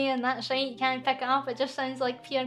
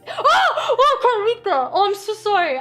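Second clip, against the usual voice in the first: shrieking laugh, much higher and louder; shocked laughing; 9 semitones higher, 11 dB louder than usual. Real, not acted. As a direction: burst out laughing in disbelief. Shocked by a rude fan message.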